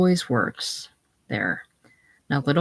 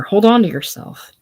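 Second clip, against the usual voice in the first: higher and louder, sharp command; forceful command; 5 semitones higher, 6 dB louder than usual. Acted. Jim shakes Eva and commands her, louder.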